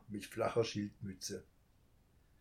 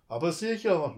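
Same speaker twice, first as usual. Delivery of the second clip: bravely lying; 5 semitones higher, 8 dB louder than usual. A narrator's reading. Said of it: "log der Einbrecher tapfer".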